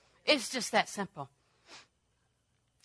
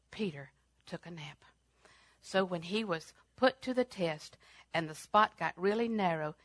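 ordinary delivery

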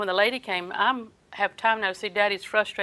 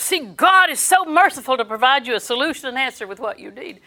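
usual speaking voice

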